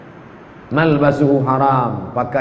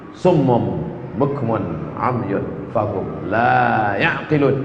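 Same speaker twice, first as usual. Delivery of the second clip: slow, lower chanted verse; slow recitation; 3 semitones lower, slower than usual. Acted. Preacher reciting a verse on the deaf and blind, slow chant. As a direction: draw the verse out slowly.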